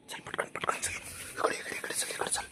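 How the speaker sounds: breathy hurried whisper. Real, not acted. Owner whispers commands to the dog at night, whisper. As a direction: keep it hushed, coax him along.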